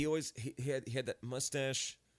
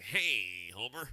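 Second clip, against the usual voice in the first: nasal Ned Flanders voice; 5 semitones lower, slower than usual. Retold or imitated. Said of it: "that real nasally voice".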